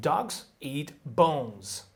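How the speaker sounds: slow, "Dogs", "eat", "bones" each given equal weight; three punched beats; every word stressed. Shown as a demonstration. Teacher shows all content words stressed, slowly. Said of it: "They all get stressed".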